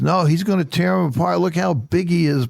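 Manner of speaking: put-on high, insistent child's voice; emphatic little boy. Grandson insisting to Papa that Goliath wins, high and emphatic. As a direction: play the kid who is sure David will lose. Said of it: "And Elliot's very emphatic".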